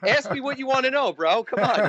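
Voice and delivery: high, laughing mock tough-guy push; Brooklyn attitude. New Yorker mocking his own attitude to Aussies, laughing Brooklyn swagger. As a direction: play up a cocky Brooklyn attitude. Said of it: "I gotta have an attitude".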